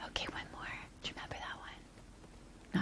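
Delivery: soft unvoiced whisper. Real, not acted. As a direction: lean in and whisper. Teacher whispers to child.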